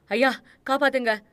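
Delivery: loud, high desperate call from outside; cry for help. Acted. Driver begging his master to save him, loud high cry. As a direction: scream for rescue from beyond the door.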